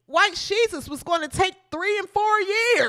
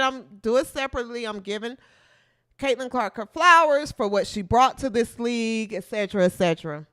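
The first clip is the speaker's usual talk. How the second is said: lower, dismissive mock quote, lightly voiced; mocking Shirley; 9 semitones lower, much faster than usual. Vlogger mocking Shirley's grudging praise, low and dismissive. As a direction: brush it off like a reluctant rival. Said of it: "she said".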